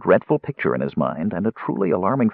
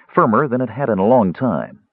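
ordinary delivery

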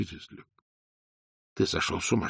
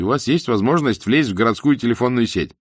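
usual speaking voice

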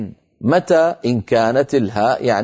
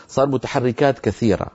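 ordinary delivery

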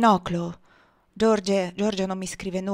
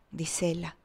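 plain reading voice

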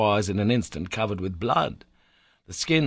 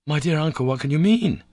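half-delirious reply; 6 semitones higher, 4 dB louder than usual. A narrator's reading. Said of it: "was my half-delirious reply".

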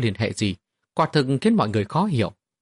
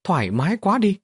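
shout; 8 semitones higher than usual. Acted. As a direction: yell it out, feeling great. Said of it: "thét to một tiếng".

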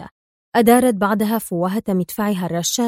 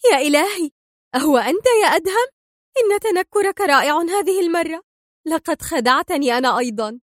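high-pitched, delighted astonishment; happy exclamation; 8 semitones higher than usual. A narrator's reading. Mona recognising Adham in disguise, happy. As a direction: exclaim with joyful relief. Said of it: "هتفت في سعادة".

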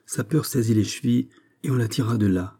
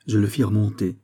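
ordinary delivery, nothing marked

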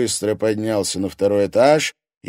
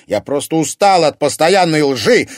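loud, high furious cry; fed up, shout it out; angry exclamation at lies; 9 semitones higher, 10 dB louder than usual. A narrator's reading. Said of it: "гневно воскликнул Тимофей".

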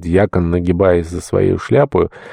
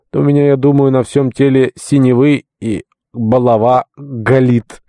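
aching complaint; 6 semitones higher, 3 dB louder than usual. A narrator's reading. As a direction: drag the words out in a high, pained whine. Beaten Varnava complains of pains. (жалобно, протяжно, высоким голосом)

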